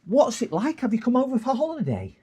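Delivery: high, loud, eager questions; imitated excited assistant. Shop girl quizzing supposed New Yorker, mock excited.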